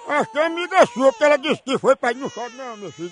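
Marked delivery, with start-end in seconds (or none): 2.2-3.1 soft, hushed soothing aside; quietly calm a crying baby mid-sentence; hushing a baby. Pretend. Caller soothes the pretend crying baby, soft hush.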